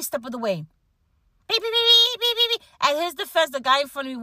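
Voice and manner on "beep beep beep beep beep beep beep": high, sharp beep-beep horn mimicry; imitated car horn. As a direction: squeak out the horn like an annoying driver. Mimicking a honking driver.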